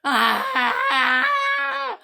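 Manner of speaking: long, loud acted scream of anger; frustrated scream. Child whose book was taken, angry scream.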